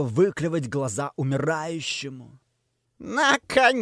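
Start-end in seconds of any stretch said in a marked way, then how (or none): 3.0-3.8 high gloating cackle of triumph; evil laugh. A narrator's reading. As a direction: laugh it out like a demon. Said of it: "исходит зловещим смехом Самхайн".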